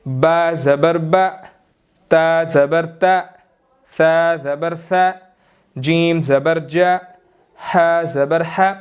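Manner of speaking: each syllable spelled out slowly; teacher's slow spelling drill; slow spelling demo. Shown as a demonstration. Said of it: "जैसा कि".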